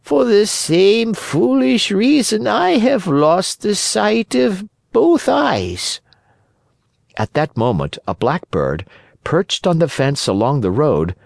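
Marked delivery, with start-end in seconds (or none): 0.0-6.0 high, slow cat voice; high, drawn-out feline self-pity; woeful sly cat. Acted. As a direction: lament your eyes in a high drawl. Cat's voice in the story.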